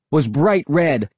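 ordinary delivery

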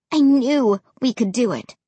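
ordinary delivery, nothing marked

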